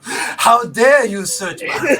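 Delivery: offended, draw it out; indignant outrage. Acted.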